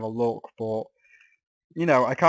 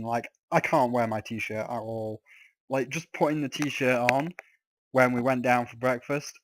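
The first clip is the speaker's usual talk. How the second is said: ordinary talk, nothing marked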